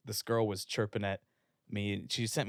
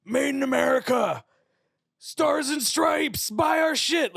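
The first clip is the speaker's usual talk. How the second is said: much higher, loud hammed-up ad shout; over-the-top mock ad announcer, very slow; mock patriotic ad; 9 dB louder, slower than usual. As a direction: yell it like a cheesy flag-waving commercial. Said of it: "the Latch On to America type of shit where they're like".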